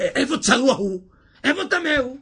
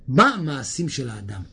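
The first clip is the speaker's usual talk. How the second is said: usual speaking voice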